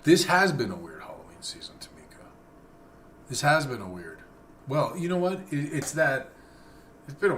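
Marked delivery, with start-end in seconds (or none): 0.0-2.2 whispering. Real, not acted. Whispered aside on stream.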